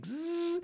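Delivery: drawn-out dental drill buzz; imitated drill buzz. Imitating the drill in the film's tooth scene, long buzzing noise.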